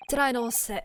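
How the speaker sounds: lightly done Scottish prison governor; a hint of a Scottish brogue, half-hearted; Scottish accent. Prison governor greeting the lawyers in a light Scottish accent. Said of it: "how to do this accent".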